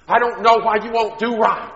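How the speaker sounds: high, loud, fed-up scolding; frustrated parent. Retold or imitated. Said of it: "Have you ever heard parents say".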